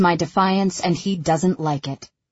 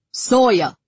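raised, louder commanding call; Callum's commanding voice; 5 semitones higher, 5 dB louder than usual. A narrator's reading. Father calling a son who ignores him, commanding. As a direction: throw the name up high as an order. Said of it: "Callum's commanding voice bounces off the walls".

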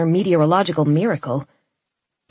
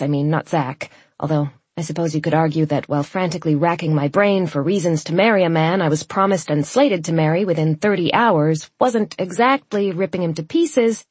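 ordinary delivery

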